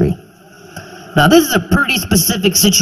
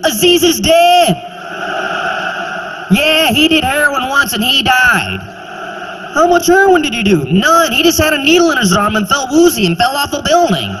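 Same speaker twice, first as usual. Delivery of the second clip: high, fast, excited gossip; the friends spread the news in a high, fast rush; mock news gossip; 8 semitones higher than usual. Friends discuss his overdose death.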